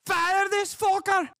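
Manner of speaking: very high, squeaky Scottish parody; mock-demand in a piercingly high Glasgow uni accent. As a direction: falsetto Scottish caricature. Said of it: "She has a Glasgow uni accent".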